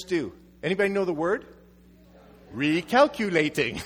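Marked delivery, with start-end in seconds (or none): 2.5-3.8 robotic recalculating announcement; mock navigation voice; GPS voice. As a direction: say it like a sat-nav. Mimicking GPS word.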